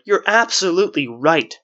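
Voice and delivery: shout. A narrator's reading. Man cutting off the wisp, shouting. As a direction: cut in with a shout. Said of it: "shouted the man".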